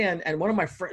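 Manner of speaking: usual speaking voice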